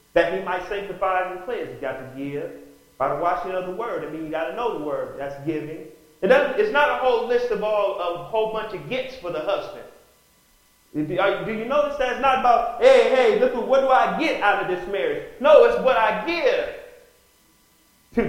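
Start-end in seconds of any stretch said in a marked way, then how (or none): 6.2-18.2 loud, high impassioned preaching. Real, not acted. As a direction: preach it with fire to the congregation. Preacher at full force.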